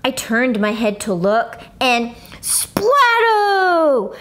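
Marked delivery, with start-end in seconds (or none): 1.8-4.2 slow, high dramatic splat; drawn-out high exclamation; dramatic splatto. Acted. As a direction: stretch it out for the gross reveal. Vomit moment in story.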